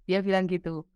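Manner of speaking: usual speaking voice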